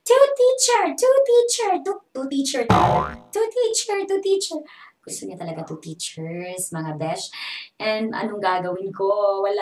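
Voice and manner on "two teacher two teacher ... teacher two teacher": put-on high, loud childish yelling; imitating child shouting. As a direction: chant it like a demanding kid. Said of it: "nagsisigaw talaga siya". (ginagaya ang sigaw ng bata)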